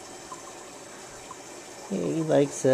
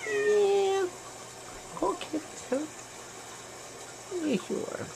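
high soft cooing; 8 dB quieter than usual. Real, not acted. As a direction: coo softly at the kitty. Baby talk to the cat.

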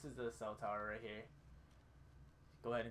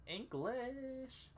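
exasperated; 13 semitones higher than usual. Real, not acted. Fumbling the word survive.

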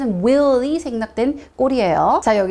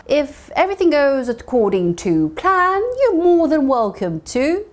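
slow model read; 3 semitones higher, much slower than usual. Shown as a demonstration. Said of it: "teacher models sentence slowly".

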